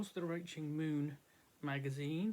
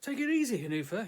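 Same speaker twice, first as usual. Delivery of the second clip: cries out; 6 semitones higher, 5 dB louder than usual. A narrator's reading. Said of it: "he cries, pulling back".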